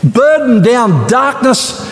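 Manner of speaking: rising sermon fervour; preaching at full force. Acted. Preaching build-up.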